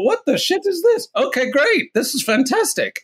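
high-pitched, retold amazement; astonished delight. Realtor thinking after a surprise call, mock amazed delight. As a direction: re-enact a thrilled reaction, pitch leaping way up.

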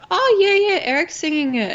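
high, lively mock reply; retold breezy agreement. Acting out her breezy reply to friend.

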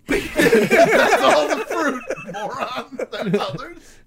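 mocking laugh. Mocking friend's fruit list.

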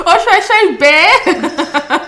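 slow, high laughing pun; milk the pun while laughing. Real, not acted. Punchline joke, laughing.

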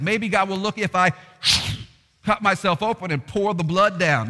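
prophet of Baal. Retold or imitated.

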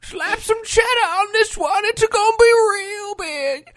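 very high, slow, loud codger voice; hammed-up old man calling Dorothy; mock old man. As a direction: drawl it like a grumpy grandpa ordering. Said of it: "[name]'s in the back, just going".

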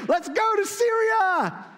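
high, loud, drawn-out rallying shout; mock war cry. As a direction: holler it like a hammed-up charge.

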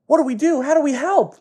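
put-on very high, frantic boy voice; urgent high-pitched plea; panicked kid. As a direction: squeak it out like a worried cartoon kid. Two boys panicking down below.

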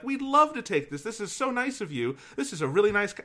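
high, sweet imitated gratitude; gushing nice lady. Goodwill lady thanking the donor for the couch, high gushing.

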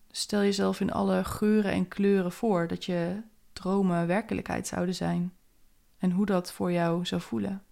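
guided meditation. Acted. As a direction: guide the listener gently inward.

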